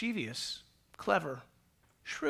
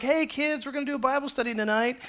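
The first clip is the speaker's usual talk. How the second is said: put-on higher, fast, peppy call to the kids; mock-eager parent rounding up the children; chirpy parent; 6 semitones higher than usual. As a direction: announce it like an over-keen parent. Parent announcing family Bible study.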